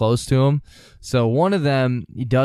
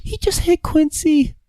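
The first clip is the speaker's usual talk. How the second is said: much higher, aghast falsetto; imitating Treadwell. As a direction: gasp it out in shocked high falsetto. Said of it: "He's like".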